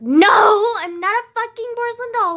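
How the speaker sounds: loud, high, furious protest; angry outburst. Real, not acted. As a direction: shout it out, fed up. Angry outburst about being coddled.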